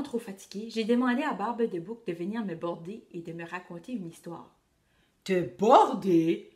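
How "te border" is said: slow, lower, louder incredulous growl; pirate chief scoffing at being asked to tuck in; captain thundering. A narrator's reading. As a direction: drag out the outrage. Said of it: "a-t-il tornituré".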